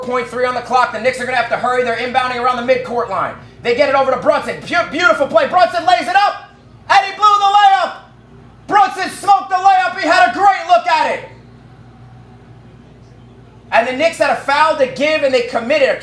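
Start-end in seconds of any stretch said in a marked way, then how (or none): 4.6-8.0 excited call. Real, not acted. Live call of a missed layup. 8.7-11.3 disbelieving shout. Real, not acted. Live call of a missed layup.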